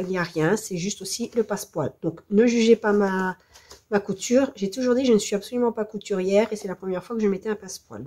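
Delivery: normal talking voice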